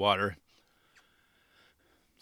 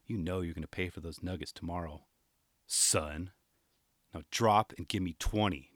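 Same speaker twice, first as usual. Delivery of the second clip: low hushed warning into his ear; whispered threat; 7 semitones lower, 6 dB quieter than usual. Retold or imitated. Mom whispering a threat into her son's ear. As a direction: hiss it close like a strict mom. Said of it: "leaned in and whispered".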